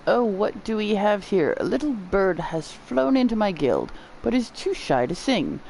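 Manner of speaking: lightly voiced, courtly and playful character; a hint of a flowery-spoken guild figure; theatrical guild master. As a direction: greet a shy visitor with a theatrical flourish. Voicing the guild weaver character.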